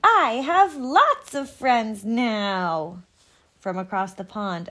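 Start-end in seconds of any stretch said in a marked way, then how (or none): 0.0-3.0 loud, slow, joyful shout; very loudly. Acted. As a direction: shout it out happily. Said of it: "he said it again very loudly".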